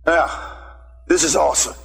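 ordinary talk, nothing marked

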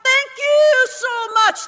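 loud, high, drawn-out cry of thanks; retold over-the-top relief; exhausted gratitude. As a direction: wail your thanks to God, hammed up. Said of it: "I'd be so exhausted".

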